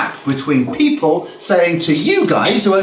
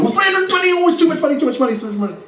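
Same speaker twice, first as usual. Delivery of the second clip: high-pitched hammed-up protest, words tumbling out; panicked customer; 11 semitones higher, much faster than usual. Customer balking at the optician's price, high panicked protest. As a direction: wail the objection in a high, comic voice.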